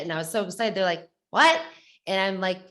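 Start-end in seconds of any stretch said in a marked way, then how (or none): 1.3-1.6 high, loud startled yelp; shocked what. Retold or imitated. Runners shocked to learn she has MS, loud high startled what. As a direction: blurt it out in disbelief.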